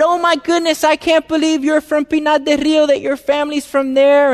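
"Oh, my goodness" thrown up high, excited; excited old man. Retold or imitated. The owner's dad, thrilled to meet a countryman, high excited. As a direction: gush like a delighted old Cuban. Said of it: "he was all excited".

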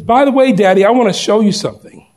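put-on high, eager child's voice; a boy coaxing his dad; little boy voice. Son tugging at his father, high and eager.

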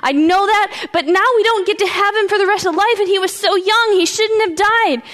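high, grieving protest; distraught pleading. Acted. Martha protesting her brother's death to Jesus, distraught and high. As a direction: plead upset, pitch held high.

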